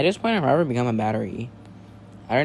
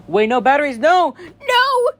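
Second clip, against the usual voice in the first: rising, higher and louder cries of no; panicked scream; 13 semitones higher, 7 dB louder than usual. Acted. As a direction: shout in terror as they close in. Batteries arriving, panicked shouting.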